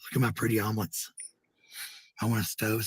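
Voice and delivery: ordinary talk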